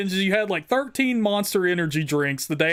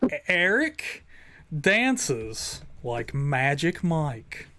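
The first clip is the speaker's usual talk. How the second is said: announcer voice; 5 semitones lower, 4 dB quieter, much slower than usual. Acted. Host announces the stream goal.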